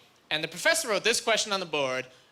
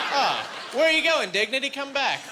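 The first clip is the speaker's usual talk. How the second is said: mock plea; 6 semitones higher than usual. Calling after his lost dignity.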